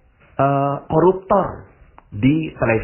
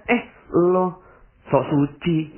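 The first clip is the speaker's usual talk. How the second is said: higher sneering taunt; mocking jibe; 6 semitones higher, much slower than usual. Gossiping neighbour mocking, higher sneering taunt. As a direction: sneer at the holier-than-thou. Gossiping woman mocks the speaker.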